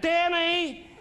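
high calling shout; yelling out. Retold or imitated. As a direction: call the boy across the street. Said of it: "she yells out".

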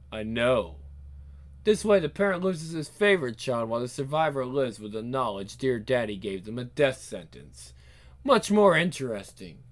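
low, soft, measured cruelty; unruffled, deep-pitched menace; calm villain. Acted. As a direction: explain coldly and quietly. Villain calmly explaining cruelty.

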